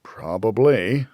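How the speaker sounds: ordinary delivery, nothing marked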